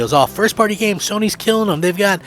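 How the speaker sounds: a hint of a smug Sony fan; mocking hyped fans. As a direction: parody the social media crowd gushing. Mocking social media Sony fans.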